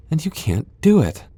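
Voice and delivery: high, pointed jeer; mocking taunt. Vampire taunting the hunter who can't use the stake. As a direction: rub in their weakness.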